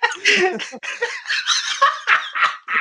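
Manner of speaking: high laugh, breaking up; helpless laugh at the memory; big laugh. Real, not acted. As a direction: lose it laughing. Cracking up at own story.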